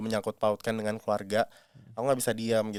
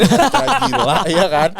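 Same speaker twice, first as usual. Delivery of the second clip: laughing; 9 semitones higher, 11 dB louder than usual. Real, not acted. Laughing at own rant.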